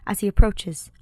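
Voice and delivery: usual speaking voice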